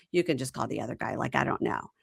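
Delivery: mock dismissive mumble. Quoting his flat dismissal.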